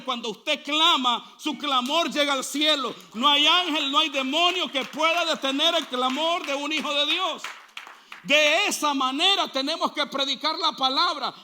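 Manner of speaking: loud, fervent preacher; full-force preaching. Acted. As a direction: preach it out to the whole church. Predica con fuerza. (predicación a todo pulmón)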